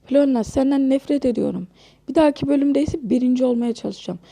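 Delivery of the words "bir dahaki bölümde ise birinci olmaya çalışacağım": ordinary talk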